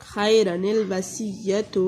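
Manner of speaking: "खैरनिल वसीयतु" drawn out, nun linked to lam; slow Quranic recitation. Shown as a demonstration. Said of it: "लाम के साथ वसल करते हैं".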